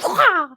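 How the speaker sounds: high loud mock donkey yell; sudden loud squawk; donkey cry. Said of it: "like that".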